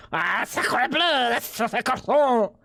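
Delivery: hammed-up French chef in a French accent. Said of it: "it's the French man in chat".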